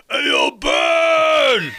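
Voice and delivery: slow, high, loud call; drawn-out shouted toy line; action figure catchphrase. Pretend. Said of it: "your action figure would say".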